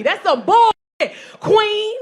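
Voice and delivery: loud, slow shout; yelling at the TV. Pretend. Comic yelling at the TV show, loud slow shout. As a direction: yell it at the television. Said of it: "Just yell at the T V".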